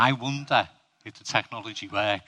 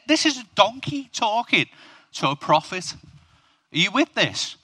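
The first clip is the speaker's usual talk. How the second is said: ordinary delivery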